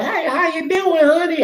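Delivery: imitating a friendly Texas waitress, way up high; a high, sugary Southern "honey"; Southern accent. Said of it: "They got that Southern accent".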